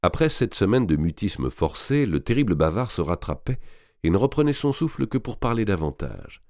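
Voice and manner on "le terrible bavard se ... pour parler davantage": usual speaking voice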